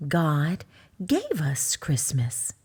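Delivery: slow, low mama bear voice; mother bear. Acted. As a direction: say it as a patient mama. Mama Bear answers the cub.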